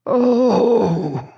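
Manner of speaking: high, tearful "oh"; shaky release. A narrator's reading. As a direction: let out a long, shaky, grieving sigh. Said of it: "The sound was a shaky release".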